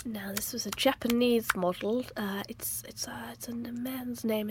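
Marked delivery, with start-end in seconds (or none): 2.2-4.5 whispering. Pretend. Whispered hint.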